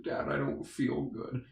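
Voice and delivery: put-on slow, breathy whimper of a sick boy; weak sick kid. Sick boy whimpers to his coach father, hushed and slow. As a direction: plead weakly to dad. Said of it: "And he's like".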